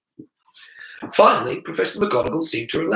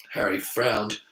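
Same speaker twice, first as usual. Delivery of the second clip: usual speaking voice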